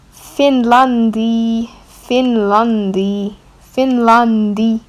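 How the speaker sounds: Finlandi said slowly, stress on lan, ending in a clear i; Moroccan pronunciation. Shown as a demonstration. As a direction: spell out Finlandi slowly like a teacher. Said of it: "you're gonna say".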